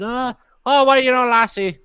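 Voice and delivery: loud, high Irish brogue; jaunty high Irish voice; Irish accent. Acted. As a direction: lay the Irish accent on thick. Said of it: "I wasn't from Ireland".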